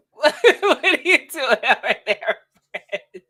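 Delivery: slow and high through laughter; laughing. Real, not acted. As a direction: ask while cracking up. Laughing at partner.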